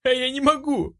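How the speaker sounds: high-pitched desperate cry; anguished high yell. A narrator's reading. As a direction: cry out in despair. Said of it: "закричала я".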